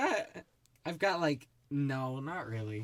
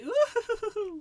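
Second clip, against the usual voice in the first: high, loud mock whooping dad; squeaky gleeful woo-hoo; giddy whoop; 6 dB louder than usual. Giddy dad voiced in story.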